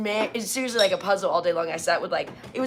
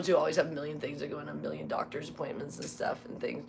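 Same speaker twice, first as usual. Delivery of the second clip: ordinary talk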